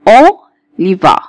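"اوليفار" slowly split as O, pause, li-va; syllable-split demo. Shown as a demonstration. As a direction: break "اوليفار" after the first O. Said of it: "زي كلمة ايه؟".

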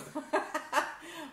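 a quick high laugh; short amused laugh. Real, not acted. To viewers after the stream failed, a short laugh.

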